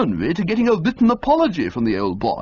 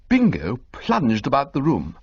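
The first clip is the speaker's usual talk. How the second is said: usual speaking voice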